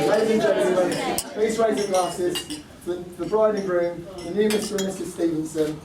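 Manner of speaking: loud, proud toast to the couple; wedding toast. Acted. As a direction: raise the glass and call it out. Raises glasses toast.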